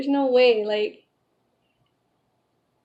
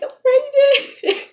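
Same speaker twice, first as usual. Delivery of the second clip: retold high, loud, slow cry of news; tearful joy; 14 semitones higher, 7 dB louder, slower than usual. Woman revealing pregnancy to her fiance, tearfully overjoyed. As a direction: relive a happy wail, drawn out.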